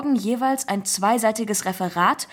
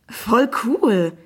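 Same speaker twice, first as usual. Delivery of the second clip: put-on thrilled boy calling out, louder; excited exclamation; 6 dB louder than usual. A narrator's reading. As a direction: burst out, swooping up on cool. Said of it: "rief der Junge". (begeistert ausrufend)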